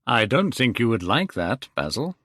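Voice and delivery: usual speaking voice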